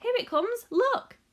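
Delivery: very high, loud eager call; excited cry. A narrator's reading. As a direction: point and cry out with delight. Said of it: "he cried".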